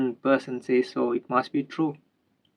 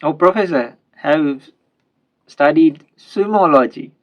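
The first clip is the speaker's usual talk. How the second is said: louder, urgent burst of a question; sudden eager question; 3 semitones higher, 8 dB louder than usual. Acted. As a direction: blurt it out all at once, louder. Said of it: "he asked suddenly".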